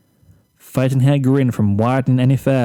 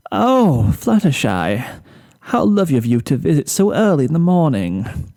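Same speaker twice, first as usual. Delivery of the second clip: forced cheer; 2 semitones higher than usual. Acted. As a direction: fake the delight while exhausted. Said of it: "stretched her cheek muscles to tighten up a smile".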